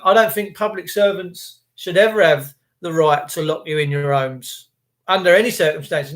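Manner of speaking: ordinary talk, nothing marked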